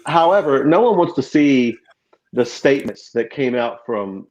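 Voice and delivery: ordinary talk, nothing marked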